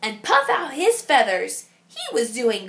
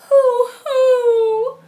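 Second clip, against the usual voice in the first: high loud mournful hoots; sad owl hoots; 11 semitones higher, 7 dB louder than usual. Acted. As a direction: hoot out your sadness. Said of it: "cried Owl sadly".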